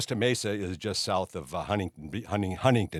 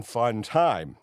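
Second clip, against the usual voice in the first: ordinary delivery, nothing marked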